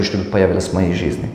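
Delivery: normal talking voice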